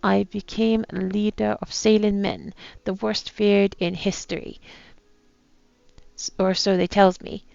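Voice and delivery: attempted pirate accent, reading aloud. Captain writes his last words, attempted pirate accent. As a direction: read it like an old pirate captain. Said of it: "my attempt at a pirate accent".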